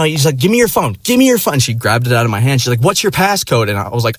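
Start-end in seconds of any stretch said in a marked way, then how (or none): 0.4-1.6 high, mock female demand; pitched-up bossy woman impression; imitating demanding girl. 2.8-3.7 high mock female question; imitating demanding girl.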